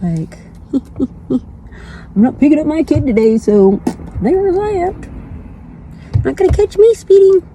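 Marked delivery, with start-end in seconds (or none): 4.2-7.5 much higher playful taunt. Acted. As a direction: tease the cops in a high voice. Taunting passing cops.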